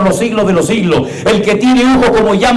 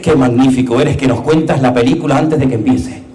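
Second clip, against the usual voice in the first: reverent whispered adoration; hushed praise; 9 semitones lower, 3 dB quieter than usual. Acted. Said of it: "es para que tú digas".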